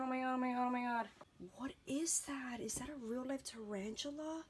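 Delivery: panicked hushed whisper; frightened, breathless under the breath; screaming at a spider. Real, not acted. Spider found in her room.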